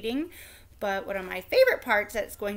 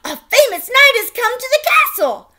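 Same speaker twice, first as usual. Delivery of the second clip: much higher, louder proclamation; herald announcing; 4 semitones higher, 11 dB louder than usual. A narrator's reading. Royal crier proclaiming a knight's arrival, loud and high. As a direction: announce it to the whole court.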